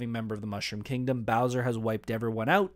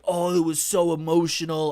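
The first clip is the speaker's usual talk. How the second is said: mock gushing, a bit higher and louder; gushing audience member; 4 semitones higher, 5 dB louder than usual. Cosplayer imagining the crowd's praise, mock gushing. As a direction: lightly imitate a moved fan.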